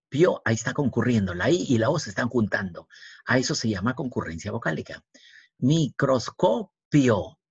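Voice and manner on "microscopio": slow "Microscopio", mi-cros-co-pio with gaps; syllable by syllable. Shown as a demonstration. Teacher locating the final diphthong, "Microscopio" slowly by syllables. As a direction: stretch "Microscopio" into separate beats.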